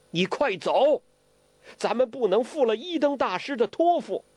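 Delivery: high, earnest urging; urgent insistence. Acted. Guo Jing telling Huang Rong to go first, urgent. Hero urging companion to leave.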